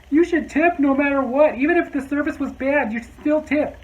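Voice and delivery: high, loud, mocking lecture; mock the pushy tippers' sermon; mocking preachy tipper. Mocking people who insist you always tip, in a high preachy voice. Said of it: "I'm not one of those people who goes".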